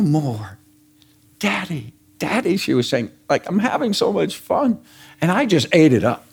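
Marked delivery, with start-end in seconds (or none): 1.4-2.6 high, soft imitation of a little girl; excited grandchild. Granddaughter calling grandpa in the pool, mildly excited. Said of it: "she was getting so excited". 3.5-4.8 higher-pitched imitation of a happy child; excited grandchild. Granddaughter having fun swimming with family, lightly excited. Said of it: "she was getting so excited".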